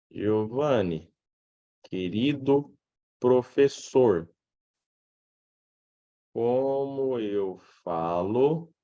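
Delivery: stretched words, as if typing them out; slow dictation. Acted. Student writes to dear teacher in the group, slow dictation. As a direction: spell out the message word by word, slowly.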